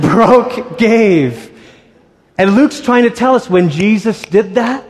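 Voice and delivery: usual speaking voice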